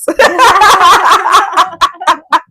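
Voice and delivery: hearty laugh; loud laugh. Real, not acted. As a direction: burst of delighted laughter. Big laugh after joyful point.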